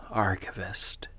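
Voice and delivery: breathy disbelieving whisper of the word. Acted. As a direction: let the word fall away to a whisper. Echoing the offer in disbelief.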